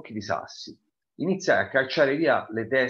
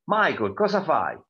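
a mild, slow call from the back door, a little higher and louder; mum calling out; 4 semitones higher, 4 dB louder than usual. Acted. Mother calls Michael from the back door, mild mock shout. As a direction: call the boy from across the yard. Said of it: "sentii mamma gridarmi qualcosa".

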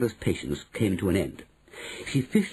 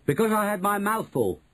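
put-on cheeky boy voice; 11 semitones higher than usual. A narrator's reading. Bruno's voice.

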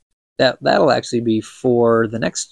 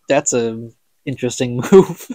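ordinary delivery, nothing marked

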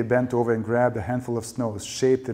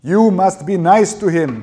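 high, slow, very loud yell; drawn-out furious scream; 9 semitones higher, 13 dB louder than usual. A narrator's reading. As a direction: scream it as an order, drawing the words out. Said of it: "Dado screamed at her".

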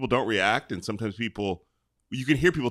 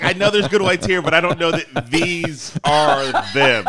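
much higher and louder, slowed, laughing through it; laughing mock doubt; 8 semitones higher, 10 dB louder, slower than usual. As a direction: tease with a laugh. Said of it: "I was like".

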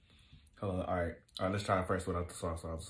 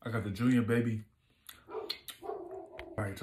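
chewing while talking; talking between bites of burger; mouth full; 4 dB louder than usual. Real, not acted. Seen 0: holds burger, lips pressed, mouth seems full after bite.

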